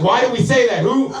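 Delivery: high-pitched, incredulous little head voices; panicking inner selves. Acted. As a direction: demand answers in high-pitched alarm. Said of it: "a bunch of miniature Travises in my fucking, like, head".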